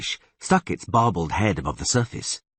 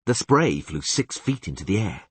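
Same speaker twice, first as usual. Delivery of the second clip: plain reading voice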